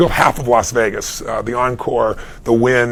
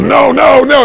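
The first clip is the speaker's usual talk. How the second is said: laughing denial; 10 semitones higher, 6 dB louder, slower than usual. Retold or imitated. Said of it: "And he laughed".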